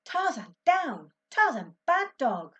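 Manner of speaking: loud, high telling-off; boy yelling at the dog on his bed; scolding dog. A narrator's reading. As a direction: shout the dog down.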